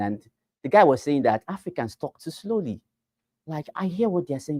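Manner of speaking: usual speaking voice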